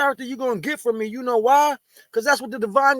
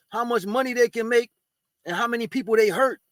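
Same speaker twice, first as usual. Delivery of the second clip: ordinary talk, nothing marked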